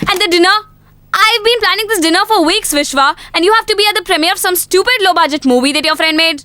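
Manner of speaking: high, aggrieved outburst; indignant anger. Acted. Girlfriend whose planned dinner is cancelled, indignant. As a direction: press the grievance in one heated run.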